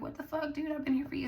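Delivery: high mock indignant protest; hammed-up wounded friend; mock hurt surprise. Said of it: "They're like".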